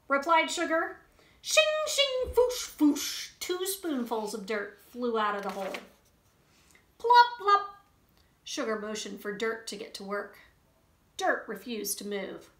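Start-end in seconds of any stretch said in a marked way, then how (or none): 1.4-3.3 high, loud shovel sound effects; digging noises. Acted. 7.0-7.8 high, loud shovel sound effects; digging noises. Acted.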